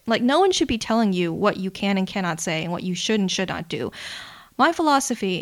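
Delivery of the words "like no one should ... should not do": ordinary talk, nothing marked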